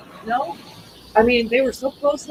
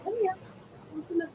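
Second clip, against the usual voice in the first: talking to dog; 6 semitones higher, faster than usual. Real, not acted. Calling and coaxing the dog.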